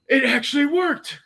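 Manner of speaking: high-pitched astonished gasp and exclamation; gasp, then exclaim it high in mock disbelief; bewildered. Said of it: "he is just bewildered".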